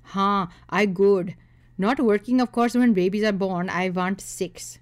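put-on smug suitor with an Indian accent. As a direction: drag out the demands in an Indian accent. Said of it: "ones with thick accents and head bobbing".

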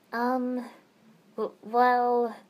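halting, stammered start; stuttering. Acted. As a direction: trip over the first words. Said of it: "I stuttered".

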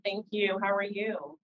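ordinary talk, nothing marked

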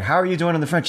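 lightly voiced father checking in; a hint of a curious father; father asking. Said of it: "his father comes in, and he's like".

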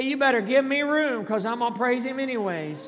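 loud preacher's call for repeating; feed the congregation the line loudly; loud prompt. Shown as a demonstration. Pastor giving congregation words for their neighbor, loud prompt. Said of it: "You need to say it like you mean it".

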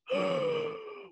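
long, high intake of breath; exaggerated gasp of wonder; mock awed gasp. Boy seeing anime first time, mock gasp.

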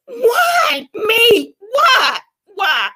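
high, sobbing cries of why; crying why me. Retold or imitated. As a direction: wail the question through tears. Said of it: "And I was just saying that".

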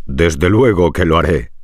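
a sly big wolf accepting. Acted. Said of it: "respondió el señor lobo".

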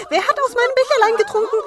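sehr hoch, laut und schnell; siebter Zwerg. A narrator's reading. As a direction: quiekend aufgebracht. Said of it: "Der siebente". (höchste, lauteste Zwergenstimme)

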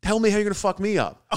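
high, loud mocking dare; hammed-up high taunt; taunting challenge. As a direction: goad him to swing. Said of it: "I go".

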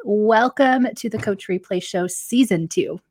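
usual speaking voice

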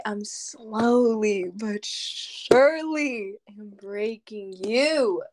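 very drawn-out, deliberate delivery; slow teasing. Real, not acted. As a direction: stretch each word out teasingly. Teases partner, stretching the words.

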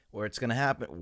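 normal talking voice

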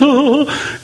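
quick laugh at the idea; a scoffing little laugh; short incredulous laugh. Real, not acted. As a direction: laugh it off as absurd.

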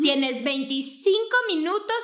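mock strict mother setting a time limit; imitating strict mom. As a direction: lay down mom's deadline, slowly. Voicing mom's Walmart deadline.

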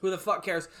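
normal talking voice

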